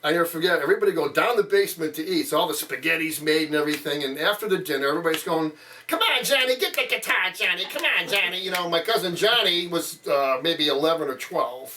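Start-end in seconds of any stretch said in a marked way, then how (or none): 5.9-8.4 calling out. Retold or imitated. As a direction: urge Johnny to play like a crowd of relatives. Relatives calling cousin to play.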